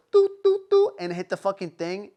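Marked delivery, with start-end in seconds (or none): 0.1-0.9 high, loud sung beeps of a phone keypad; mock dial tones. Dialing nine one one, chirped high phone beeps. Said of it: "the second you actually go".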